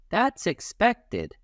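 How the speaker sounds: sing-song approval. Shown as a demonstration.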